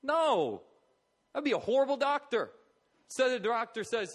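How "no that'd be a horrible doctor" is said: loud, high outburst; emphatic rejection. Real, not acted. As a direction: cry out against the very idea. Emphatic rejection of weepy doctor.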